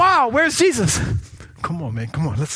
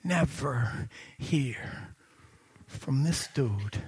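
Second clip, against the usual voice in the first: slow and quiet; 6 dB quieter, slower than usual. Acted. Dramatic slow delivery.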